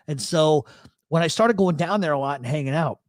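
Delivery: usual speaking voice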